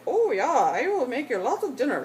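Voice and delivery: loud, sing-song fake German accent; badly faked German cousin, pitch swooping up and down. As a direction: put on an exaggerated, bouncy German accent. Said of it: "That's my bad German accent".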